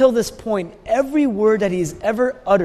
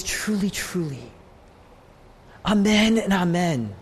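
moved, tearful. Retold or imitated. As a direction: let the words catch with emotion. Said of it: "he's weeping and he's crying and he's moved".

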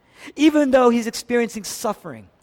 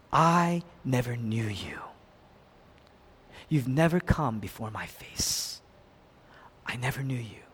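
low, hushed pronouncement; grave rejection; 8 semitones lower, 8 dB quieter than usual. Acted. Jesus turning away false worshippers, hushed and grave. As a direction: say it softly and low, sinking to near whisper.

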